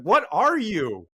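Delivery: high, loud disbelieving demand, mock outrage; incredulous shout. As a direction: yell it at him like he's a machine. Demanding Gary explain himself.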